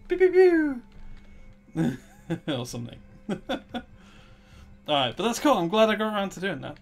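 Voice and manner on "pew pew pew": high loud cartoon blaster noises; laser pew sounds. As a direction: squeak pew pew like a toy blaster.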